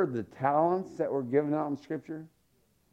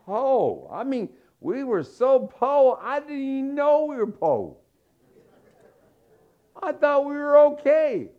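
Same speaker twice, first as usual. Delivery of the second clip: high, loud, building "po" cries; excited preaching; 9 semitones higher, 5 dB louder than usual. Real, not acted. Preacher telling the crowd his family was poor, high excited cries. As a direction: belt out how poor you were. Comic emphasis on "po.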